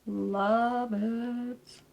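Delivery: slow, dreamy, emphatic love; drawn-out love it. Shown as a demonstration. Echoing her praise to viewers, dreamy and drawn out. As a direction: savour each word like a swoon. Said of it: "and I absolutely love it".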